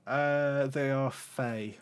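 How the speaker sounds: normal talking voice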